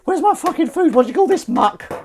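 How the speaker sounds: very high, loud, demanding cat; kitten voice. Acted. Voicing the kitten rejecting her food, high angry cat voice. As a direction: rage like a spoilt kitten over dinner. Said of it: "That's how she speaks".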